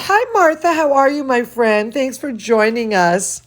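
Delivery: plain reading voice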